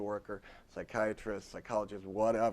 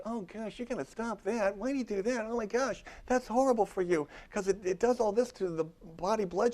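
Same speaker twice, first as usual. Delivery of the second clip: mock alarmed scolding; 11 semitones higher, 4 dB louder than usual. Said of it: "I didn't say".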